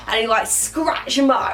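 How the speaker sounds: ordinary talk, nothing marked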